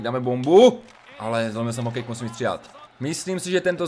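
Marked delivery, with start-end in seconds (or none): 0.0-0.8 loud, eager shout; hyped shout. Real, not acted. Gamer to viewers, winding up a big shot, loud hyped shout. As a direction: yell it as you wind up the shot.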